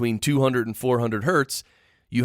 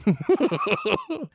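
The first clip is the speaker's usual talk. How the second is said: high giggling laughter; 11 semitones higher than usual. Real, not acted. Laughs at own joke.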